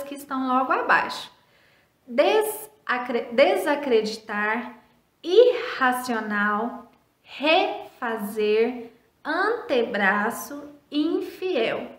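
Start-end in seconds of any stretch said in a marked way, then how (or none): 2.1-11.9 each word drawn out slowly, rising at the end; slow word list. Shown as a demonstration. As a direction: read the words out slowly to pupils. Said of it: "observando as palavras".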